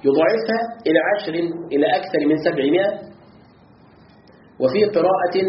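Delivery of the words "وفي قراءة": ordinary delivery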